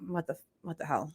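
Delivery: very soft, low aside; imitated baffled muttering. Parent quotes child told of sibling's new name, hushed bafflement. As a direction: drop right down, as if baffled under the breath.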